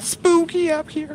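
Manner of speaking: ordinary talk, nothing marked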